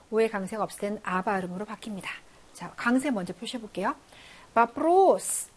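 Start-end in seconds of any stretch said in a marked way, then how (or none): 4.6-5.5 바프로스 with a long, stressed 로. Shown as a demonstration. Teacher marking stress for students, 바프로스 stressed on 로. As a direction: lean on the 로 in 바프로스. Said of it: "강세 있습니다".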